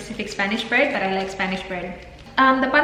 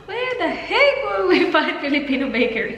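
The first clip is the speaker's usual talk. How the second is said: laughing; 7 semitones higher than usual. Retold or imitated. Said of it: "it's like".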